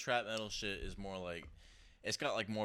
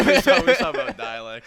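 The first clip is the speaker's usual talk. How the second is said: laughing; 10 semitones higher, 18 dB louder than usual. Real, not acted. Friend lost the thread.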